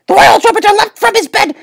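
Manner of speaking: ordinary delivery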